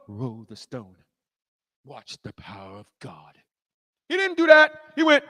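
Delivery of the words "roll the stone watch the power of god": slow, low, hushed showman piety; mock gentle Jesus. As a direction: parody a softly solemn miracle-worker. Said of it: "He didn't go".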